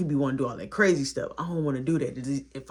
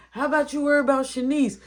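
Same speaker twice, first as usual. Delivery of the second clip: higher, louder sassy jab; sassy comeback; 9 semitones higher, 5 dB louder, faster than usual. Retold or imitated. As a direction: throw it back with attitude. Teasing comeback.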